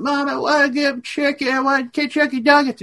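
high childish mock voice; lightly imitating a whiny child; kids begging. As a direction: squeak it like a little kid pestering mom. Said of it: "a thousand kids going".

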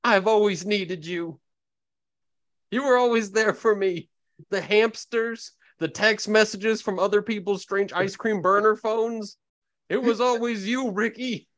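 pleading Kyle. Acted. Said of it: "So he says".

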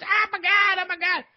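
very high falsetto panic impression; mock soul-singer squeal of distress. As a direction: wail it way up high, hammed up. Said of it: "He's doing his [name] voice".

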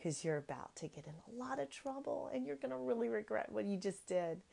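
soft, controlled voice with quiet menace; flight attendant voice. Shown as a demonstration. Mother warning kids they will regret it, in hushed flight attendant voice. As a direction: threaten very softly, without ever yelling. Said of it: "the flight attendant voice".

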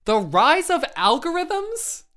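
high, slow, scoffing disbelief; mock the phrase in disbelief; incredulous. Real, not acted. Mocking the article's phrase.

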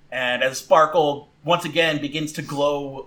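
normal talking voice

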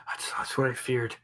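a touch of breathy dismay; lightly voiced timid bishop. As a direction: murmur it with mild dread and awe.

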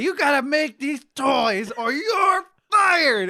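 loud, high, drawn-out mock bellow; angry boss impression. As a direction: bellow it like a raging foreman. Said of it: "His great voice. I love his voice".

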